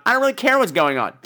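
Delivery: usual speaking voice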